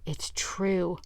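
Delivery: awed whisper. Acted. Boys peering in awe.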